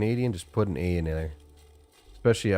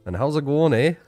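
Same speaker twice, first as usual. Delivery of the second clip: higher, louder Canadian "how's it going, eh?"; playful Canadian accent demo; Canadian greeting; 7 semitones higher, 6 dB louder than usual. Shown as a demonstration. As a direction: greet a buddy the Canadian way with a tagged-on eh. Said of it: "Quintessential Canadian expression".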